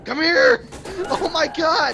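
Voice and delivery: high, loud yell; frantic shout. Real, not acted. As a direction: shout after her in a panic. Chasing the witch.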